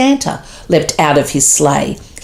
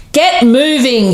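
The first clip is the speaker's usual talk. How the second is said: yelled; 7 semitones higher than usual. A narrator's reading. Santa yelling at the shocked reindeer. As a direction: bark the order at the stunned team. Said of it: "yelled Santa".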